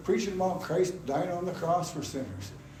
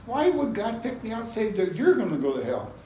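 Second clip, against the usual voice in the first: higher-pitched, incredulous questioning; indignant protest; 7 semitones higher than usual. Retold or imitated. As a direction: protest at being singled out. Voices a protest to God.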